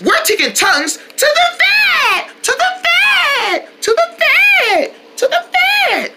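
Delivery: high, drawn-out thrilled chant; excited shout. Real, not acted. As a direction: belt it out with glee. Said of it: "I really wanna say it".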